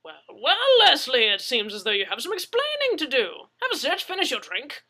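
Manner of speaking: put-on loud, booming and high old Englishman; hearty English gentleman. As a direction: boom it out like a jolly host.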